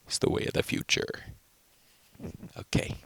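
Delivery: hushed whisper so nearby people don't hear; breathy whisper. Real, not acted. Vlogger keeping quiet near people, whispering. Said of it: "try to do an accent quiet".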